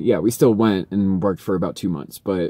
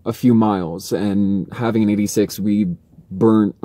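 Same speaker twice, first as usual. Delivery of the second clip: usual speaking voice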